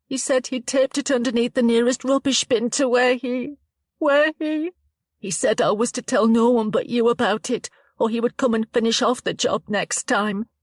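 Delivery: put-on a frightened girl telling it haltingly; shaken young woman Chloe.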